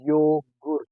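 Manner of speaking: yogurt, German oo in second syllable; German pronunciation. Shown as a demonstration. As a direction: model yogurt with an oo vowel. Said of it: "U sounds as U".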